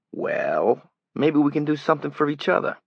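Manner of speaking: put-on a city stranger greets Johnny, loud Brooklyn accent; flat Brooklyn accent. A narrator's reading. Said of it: "He had a flat city accent as well".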